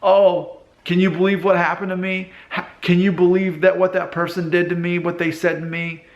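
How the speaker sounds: acting the aggrieved victim, high and insistent; attention-seeking victim. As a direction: play up the wounded complaint. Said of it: "they're crying out for attention".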